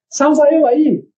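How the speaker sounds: high-pitched appeal for a favour; imitated plea. Customer asking the shopkeeper for rescue, imitated plea. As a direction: play the customer calling for rescue.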